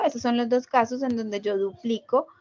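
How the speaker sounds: usual speaking voice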